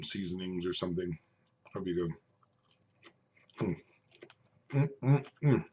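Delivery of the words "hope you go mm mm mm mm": talking through a mouthful of food; chewing while trying to speak; mouth full. Real, not acted. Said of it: "rude to talk with my mouth full".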